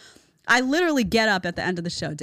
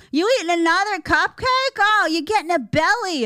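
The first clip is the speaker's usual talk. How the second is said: higher, sneering wife voice; mocking nagging wife; 10 semitones higher, 4 dB louder than usual. Wife mocking husband's belly, higher put-on sneer. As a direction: jab at his belly in a put-on voice. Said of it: "hunched over".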